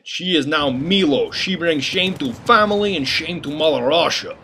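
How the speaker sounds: slow, heavy mock Russian accent; plodding, deliberate put-on Russian accent. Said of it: "I'll just narrate it for you".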